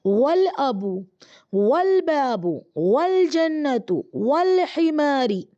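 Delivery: वल बाबू, वल जन्नतु, वल हिमारी read slowly, each वल run straight on; careful teacher drill, slow; slow Arabic recitation drill; joined waw reading. Shown as a demonstration. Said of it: "ये अलिफ भी pronounce नहीं होगा".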